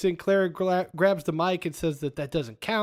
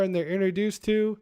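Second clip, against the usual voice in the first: ordinary talk, nothing marked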